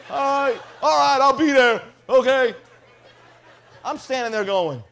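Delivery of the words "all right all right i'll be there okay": backing down. Retold or imitated. Heckler backing down, loudly.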